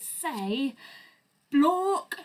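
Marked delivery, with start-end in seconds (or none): 1.5-2.1 high, loud goofy "Blork"; silly nonsense word. Acted. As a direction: blurt "Blork" like a strange creature. Reading silly book word.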